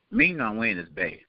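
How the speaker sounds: ordinary delivery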